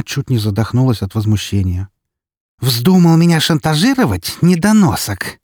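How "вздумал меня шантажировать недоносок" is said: outraged accusation. Acted. Yudina accuses of blackmail.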